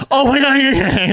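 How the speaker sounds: high, loud, drawn-out mock panic; hammed-up alarmed wail; imitated panicked cry. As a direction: cry out like a horrified instructor. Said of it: "like that, and I was like".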